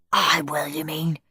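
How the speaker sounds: whispered hiss. A narrator's reading. Henry hisses back at Margaret in a whisper. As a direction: hiss it back through the teeth. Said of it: "hissed Henry".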